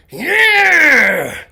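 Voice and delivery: long loud "Yeah" of thrill; excited whoop. Real, not acted. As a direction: shout it like you just floored the gas. Punching it up the hill.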